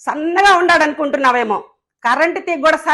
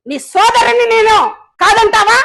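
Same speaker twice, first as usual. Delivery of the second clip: higher, louder indignant challenge; angry outburst; 5 semitones higher, 3 dB louder than usual. Real, not acted. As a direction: hurl the question at him. Confronting rival politician angrily. (ప్రత్యర్థిపై ఆగ్రహంతో గట్టిగా ప్రశ్నించడం)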